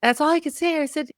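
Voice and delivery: ordinary delivery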